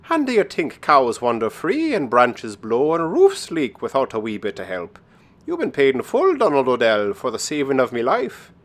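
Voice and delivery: lilting, emphatic wee-folk voice; knowing Irish leprechaun. Acted. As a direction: play a leprechaun revealing his secret, pitch leaping up. Voices the leprechaun answering Donald.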